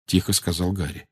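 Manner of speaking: ordinary delivery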